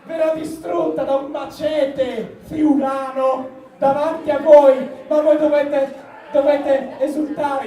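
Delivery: slow, loud showman proclamation; grand announcement. Acted. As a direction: announce it like a circus ringmaster. Pompous announcement of destruction. (annuncio solenne da imbonitore)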